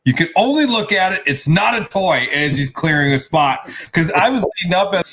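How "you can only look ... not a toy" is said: retold mock yell. Dad warning his young kids off the gift, retold mock yell. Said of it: "he, like, yells".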